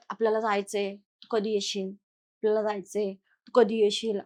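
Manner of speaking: low, slow, soft spirit whisper-like calling; haunted man retelling the spirits' call, low and slow; ghostly voices. Retold or imitated. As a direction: voice the spirits calling him away. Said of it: "असं बोलायचे".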